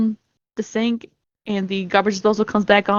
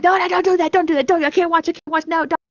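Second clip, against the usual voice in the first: higher-pitched, panicky pleading; retold freak-out; 9 semitones higher than usual. Recounting a gory horror scene to a friend, high-pitched freak-out. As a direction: act out freaking out at the screen. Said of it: "I started freaking out".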